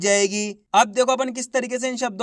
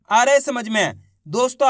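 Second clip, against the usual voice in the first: ordinary talk, nothing marked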